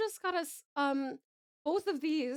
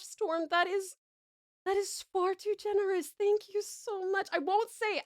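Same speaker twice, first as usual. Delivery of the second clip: normal talking voice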